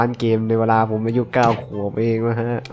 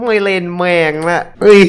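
loud, higher rage-quit; fed-up outburst; 6 semitones higher, 11 dB louder than usual. Real, not acted. Gamer rage-quits after failing again, loud and fed up. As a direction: snap and quit the game.

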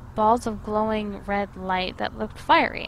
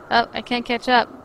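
normal talking voice

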